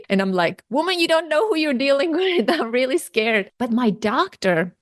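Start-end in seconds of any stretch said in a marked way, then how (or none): 0.7-3.5 high, quick mock-indignant retort with laughter. Scared patient to the blunt doctor, playfully indignant. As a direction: protest in a rush, half laughing.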